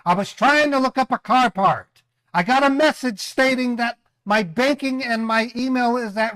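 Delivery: high, loud angry rant; furious and fed up; loud sharp voice. Real, not acted. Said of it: "Why are you yelling me".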